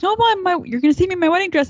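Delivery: high, loud, rushed mock wail; flustered protest. Acting out Ava's flustered protest.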